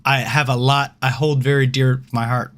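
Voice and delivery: usual speaking voice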